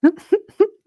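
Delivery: soaring laugh falling away; high real laugh. Real, not acted. Reader breaking after Justine's line, high laugh. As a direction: crack up.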